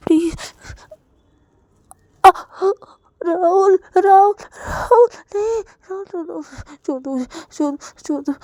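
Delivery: high, loud sobbing screams with whispered pleas; screaming and crying. Acted. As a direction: shriek his name, then beg in a whisper. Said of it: "Ravi बहुत ही चिल्लाने लगता है". (रोते-चिल्लाते हुए)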